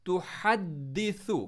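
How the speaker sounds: तुहद्दिसु slow, ह from mid-throat, द्द stressed. Shown as a demonstration. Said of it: "हा को middle throat से". (तुहद्दिसु में हा गले से, दाल पर ज़ोर)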